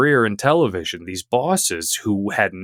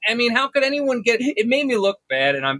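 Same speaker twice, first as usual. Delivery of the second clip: higher whining boss complaint; whiny tantrum; 13 semitones higher than usual. Acted. Boss whining to his cohost about a hard game. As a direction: sulk and whine like a petty boss.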